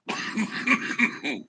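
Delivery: hard real coughing fit; rough choking cough, no words. Real, not acted. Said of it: "Getting choked out by the good one".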